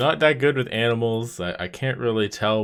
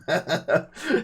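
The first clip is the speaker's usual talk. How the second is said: high laughing fit; burst of laughter; 11 semitones higher than usual. Real, not acted. Laughing at Koros confusion.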